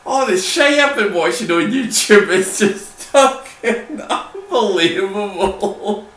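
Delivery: laughing. Real, not acted. Laughing fit.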